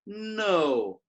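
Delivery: "No" drawn out, gliding steadily down; falling tone. Shown as a demonstration. Teacher proving English has tones, falling-tone "No". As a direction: say "No" long with a falling tone. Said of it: "You have tone there".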